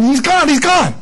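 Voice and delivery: high, loud, breathless news; Mary bursting in, frantic; excited Mary. Retold or imitated. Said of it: "then she comes in".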